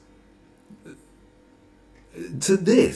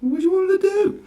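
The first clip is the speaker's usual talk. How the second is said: much higher and louder, shouting the question; exasperated shout; 6 dB louder than usual. Real, not acted. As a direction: yell it in disbelief. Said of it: "asks same question twice, escalating".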